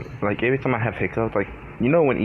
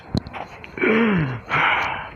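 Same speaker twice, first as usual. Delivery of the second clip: frustrated sigh; 12 semitones higher than usual. Real, not acted. Frustrated by hiccups.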